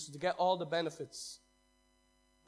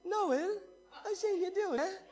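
much higher, louder Cork woman with a laugh; full Cork accent, hammed-up; stronger Cork accent; 7 dB louder, slower than usual. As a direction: lay the Cork accent on thick. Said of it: "Sorry, little Cork accent".

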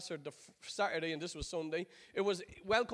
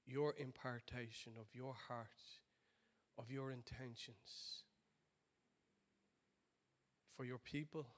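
prayer; 8 semitones lower, 8 dB quieter than usual. Acted. Pastor praying over the congregation, soft and low.

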